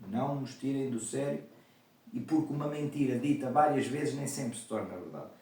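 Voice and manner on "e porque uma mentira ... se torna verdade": ordinary talk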